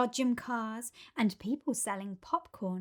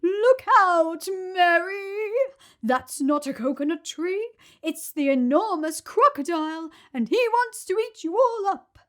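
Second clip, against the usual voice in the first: high, loud, urgent cry; hippo's urgent warning; 8 semitones higher, 9 dB louder than usual. A narrator's reading. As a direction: warn them the tree is a crocodile. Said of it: "cried Humpy Rumpy".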